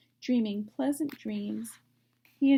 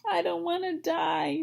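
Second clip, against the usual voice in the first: screaming; 7 semitones higher than usual. Acted. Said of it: "screamed Wilbur".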